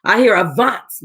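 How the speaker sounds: plain reading voice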